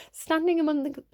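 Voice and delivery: loud, high burst of a shout; sudden shout. Real, not acted. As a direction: blurt the words out loudly. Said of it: "I don't know why I shouted that".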